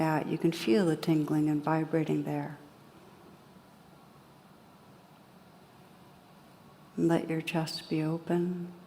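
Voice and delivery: calm, unhurried guidance with long pauses; guided meditation. Real, not acted. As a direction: gently lead listeners inward, leaving space after each phrase.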